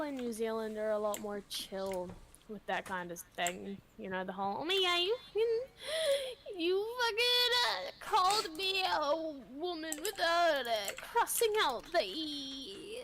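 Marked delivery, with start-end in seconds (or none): 4.6-13.0 over-the-top offended complainer; mock outrage. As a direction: put on a scandalised, offended voice.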